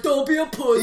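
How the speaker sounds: mock settler. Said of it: "The one guy's like".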